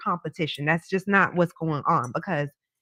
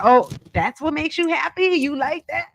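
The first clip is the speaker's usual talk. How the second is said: pleased provider man; 6 semitones higher than usual. Pretend. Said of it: "They love that feeling like".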